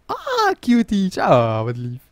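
high, gushing coo that slides down; melting with affection; adoring cooing. Real, not acted. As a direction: coo over something adorable. Cooing over sleeping game characters.

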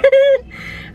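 high burst of giggles; gleeful cackle; laughter. Real, not acted. Laughs at tricked partner.

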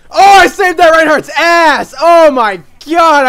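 high, loud gleeful boast; triumphant shout. Real, not acted. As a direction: crow over the save. Gloating after saving teammate.

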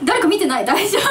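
high, loud and giggling; a laughing, hammed-up worry; laughing mock worry. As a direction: fret about peepers while laughing. Retold worry about being peeked on.

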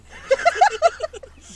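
short loud laugh; real laugh over the free Frosty; burst of laughter. Real, not acted. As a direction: laugh out in disbelief.

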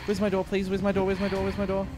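soft, rapid repeated chant under the breath; hushed, frantic looping; panicked muttering. Real, not acted. Fleeing, hunting for door.

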